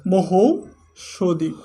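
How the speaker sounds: slow teacher demo. Shown as a demonstration. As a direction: stretch every syllable of মহৌষধি like a model word. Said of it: "হবে হর সাথে ও কারটা".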